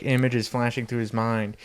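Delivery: ordinary talk, nothing marked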